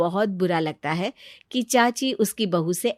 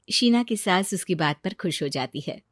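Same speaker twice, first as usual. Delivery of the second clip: ordinary delivery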